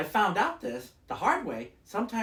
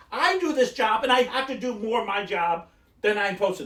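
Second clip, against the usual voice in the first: higher-pitched retold protest, building louder; exasperated complaint; 7 semitones higher, 4 dB louder than usual. Customer retelling a complaint to store worker, exasperated. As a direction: plead your case, leaning on the contrasts.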